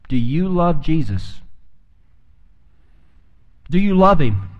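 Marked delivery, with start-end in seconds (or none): none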